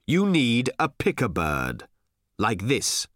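a hint of an old man, slow and sure; slow, knowing elder's voice; old Thrashbarg. A narrator's reading. Thrashbarg showing them the picker bird, slow and sure. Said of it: "he said as he walked forward".

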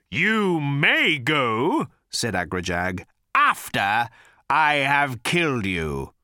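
slow, loud, far-off threat; menacing creature voice. A narrator's reading. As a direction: drag out the death threat. Agrajag threatens.

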